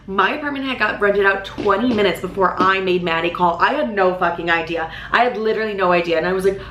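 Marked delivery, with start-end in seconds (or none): none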